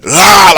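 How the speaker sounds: loud, drawn-out mock roar; imitated roar. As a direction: let out a long roar from inside. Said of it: "my spirit roars".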